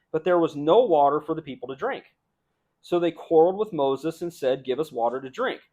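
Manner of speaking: preacher reading scripture aloud. Acted. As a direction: read the passage out with force.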